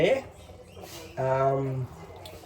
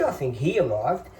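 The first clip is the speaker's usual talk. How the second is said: ordinary talk, nothing marked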